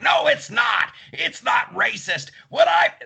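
high, loud shouted denial; retold yell. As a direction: shout him down in protest. Fred yelling at Ben.

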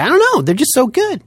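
high, breathless fanboy voice; gushing friend. Retold or imitated. Friend defending his obscure band, high gushing mock voice. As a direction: gush about the band in a lifted mock voice. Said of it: "And he's like".

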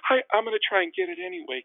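squeaky, very high voice; hammed-up meek squeak; high-pitched retold reply. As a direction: answer the intimidating player in a comic high voice. Retold timid reply to growling opponent.

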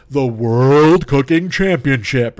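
hammed-up game-show announcer; TV announcer mock. Mocking a TV show title.

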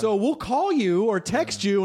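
drawn-out, smiley brush-off; unsympathetic smiling clerk. Retold or imitated. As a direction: imitate a falsely helpful hotel clerk. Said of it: "very unsympathetic with that big smile".

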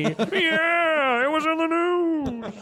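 high, choked comic whine; Neil Hamburger. Retold or imitated. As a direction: pitch it up and wail the punchline. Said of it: "He's just doing Neil Hamburger".

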